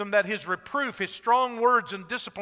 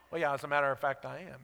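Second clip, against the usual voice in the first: deadpan reply; 7 semitones lower, 7 dB quieter, faster than usual. Retold or imitated. Blind man answers deadpan.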